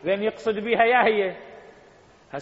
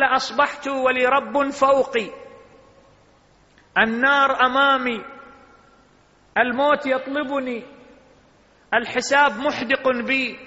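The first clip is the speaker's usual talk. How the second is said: full-force preaching; 7 semitones higher, 6 dB louder than usual. Acted. Preacher reciting the imam's words to the congregation, at full force. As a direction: declaim the imam's words from the pulpit.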